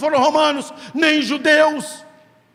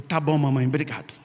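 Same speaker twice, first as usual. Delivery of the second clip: put-on meek boy; 9 semitones lower, 5 dB quieter than usual. Boy meekly answers mother.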